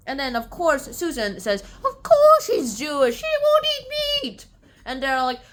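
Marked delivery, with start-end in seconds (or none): 1.8-4.5 very high, loud acted protest; exasperated defence. Susan defends Jamie to Lady Sorton, exasperated defence.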